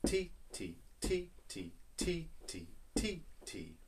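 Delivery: quick even titi on each beat; two light quick sounds per titi; rhythm syllables. Shown as a demonstration. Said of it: "say that rhythm".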